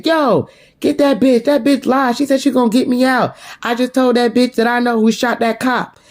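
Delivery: high, agitated imitation of a prisoner; imitated calling out. Jailed Sam telling on Burke to a detective, imitated calling out. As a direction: call out high and urgent, as a retold complaint.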